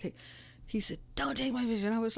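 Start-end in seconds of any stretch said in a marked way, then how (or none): none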